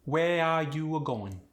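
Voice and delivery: slow, drawn-out Khmer accent; Khmer-accented with lengthened, extra vowels. Shown as a demonstration. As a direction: stretch the melody, Khmer style. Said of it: "we'll have [name] say it".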